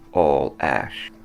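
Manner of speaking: ordinary delivery, nothing marked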